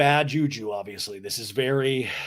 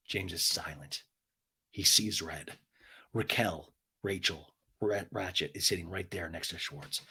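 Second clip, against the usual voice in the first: ominous hush; 8 semitones lower, 9 dB quieter than usual. A narrator's reading. Recapper telling of James seeing red, ominously hushed. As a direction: drop down low and quiet, with weighty pauses. Tense hush after the joke.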